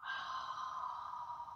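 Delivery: long whispered exhale; slow breath out. Shown as a demonstration. Author leading children in a calming breath, long exhale. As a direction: let all the air out slowly. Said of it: "Do it with me, ready?".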